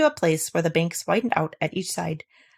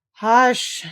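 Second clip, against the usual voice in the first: terrible voice; 5 semitones higher, 5 dB louder than usual. A narrator's reading. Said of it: "in a terrible voice".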